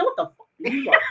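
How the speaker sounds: high, laughing mock snap; hammed-up rude phone reply; mock rude answer. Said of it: "I say".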